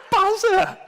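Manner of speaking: high squeal through laughter; real laughter. Real, not acted. Speaker amused by a nickname from the audience, laughing squeal. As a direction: crack up while saying it.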